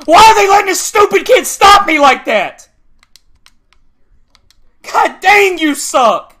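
high, loud exasperated yelling; real raging gamer outburst; furious complaint. Real, not acted. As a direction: yell in outraged disbelief. Raging at being stopped.

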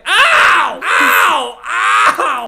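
high, loud ows; yelps of pain. Real, not acted. Player getting hit in game, pained yelps. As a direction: yelp each ow as if stung.

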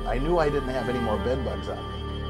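ordinary delivery

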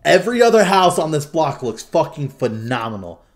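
a hammed-up gripe; mock exasperation. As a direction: fake outrage at one ugly house. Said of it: "And I'm all like".

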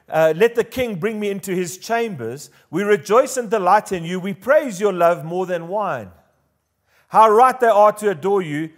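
ringing, declamatory Bible reading; impassioned scripture reading. Acted. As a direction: read the verses out boldly to the congregation. Reads scripture aloud at force.

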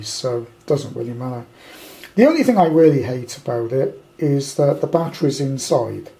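normal talking voice

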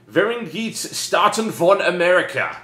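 slow, high, loud German read-out; mock German. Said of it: "this is written in German".

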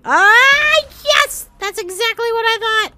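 high, loud cry of triumph; triumphant shout. Real, not acted. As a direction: yell it out in victory. Triumphant outburst in game.

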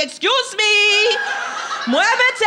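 high, drawn-out call to clear the way; sassy parade holler. Acted. Marcher at the Puerto Rican parade clearing her way, sassy holler. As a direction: holler it high and stretch each word. Acts pushing through the parade.

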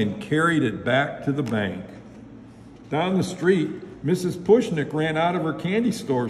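usual speaking voice

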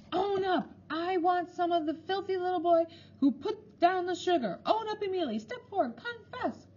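very high, shrill demand to confess; furious matron demanding the culprit own up; high-pitched ferocious matron. A narrator's reading. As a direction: screech for a confession. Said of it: "high-pitched, ferocious voice of the matron".

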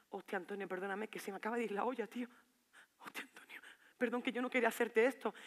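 soft, remorseful plea fading into whisper; hushed apology. Acted. Girlfriend begs Antonio's pardon after shouting, hushed apology. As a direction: drop to a near whisper.

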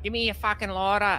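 high-pitched Australian Bogan; Bogan accent. Shown as a demonstration. Quoting the animation for friends, high Bogan accent. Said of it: "It's a Bogan".

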